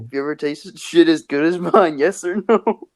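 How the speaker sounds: breathy raspy old-timer imitation; lightly voiced old wrestler; Jake Roberts impression. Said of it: "How was that Jake Roberts impression?".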